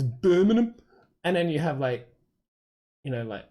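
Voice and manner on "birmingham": high, loud mock Brummie; dumb-sounding Birmingham accent. Shown as a demonstration. Mocking how Birmingham sounds to the speaker, dumb-sounding Brummie accent. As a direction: put on a hammed-up Birmingham accent. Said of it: "the way they say Birmingham".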